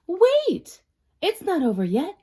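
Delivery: high, loud insistence; excited high spider; eager protest. Acted. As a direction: burst in before the end. Spider interrupts ending.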